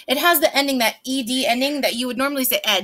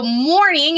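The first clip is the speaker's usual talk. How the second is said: "morning" punched higher and louder; MORNING stretched and stressed; real loud key word; 5 semitones higher, 5 dB louder than usual. Shown as a demonstration. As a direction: call out "morning" so it gets underlined. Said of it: "I'm gonna say it real loud".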